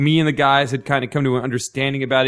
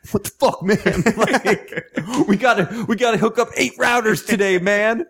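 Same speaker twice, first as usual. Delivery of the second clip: a much higher, hammed-up blue-collar guy complaining; annoyed coworker; 9 semitones higher than usual. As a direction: play the fed-up coworker with routers to install. Angry coworker quoted complaining.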